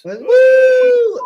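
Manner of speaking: high, loud party cheer; party whoop. Pretend. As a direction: whoop like a rowdy drunk. Party whoop after beer joke.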